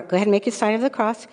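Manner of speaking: normal talking voice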